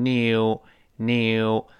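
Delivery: British "new" with a strong y glide, nyew; drawn-out British nyew for "new"; British pronunciation. Shown as a demonstration. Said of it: "英式发音有个强烈的耶在里面".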